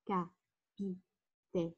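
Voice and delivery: "Capitaine" slowly in four beats, ca-pi-tai-ne; split into syllables. Shown as a demonstration. Teacher counting syllables for pupils, "Capitaine" split as ca-pi-tai-ne. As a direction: sound out "Capitaine" with a pause after each syllable. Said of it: "quatre syllabes".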